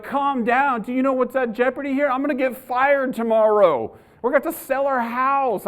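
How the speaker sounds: high-pitched incredulous panic; freaking out. Retold or imitated. Husband answering his wife about losing job, freaking out. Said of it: "I'm, like, freaking out".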